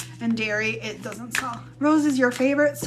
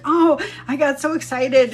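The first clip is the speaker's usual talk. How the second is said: raised, louder gushing; excited mom; 5 semitones higher, 6 dB louder than usual. Retold or imitated. Mom explaining her outburst to family, mildly excited imitation. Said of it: "when she gets excited".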